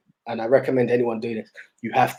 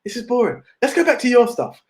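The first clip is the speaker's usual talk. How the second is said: girlfriend's bored complaint in a higher mock voice; 9 semitones higher than usual. Imitating girlfriend bored by the old films, higher mock voice. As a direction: pitch it up, imitating her whine of boredom. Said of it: "she's like".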